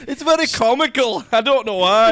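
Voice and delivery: loud, high Billy Connolly-style Scottish; hammed-up, badly faked Scottish; bad Scottish accent. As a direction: ham up a Scottish comedian's accent. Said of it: "It's into Billy Connolly".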